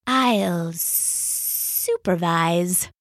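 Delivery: slow, drawn-out snake voice; snide adder dragging the words; sneering adder. Acted. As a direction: let the snake sneer it out slowly.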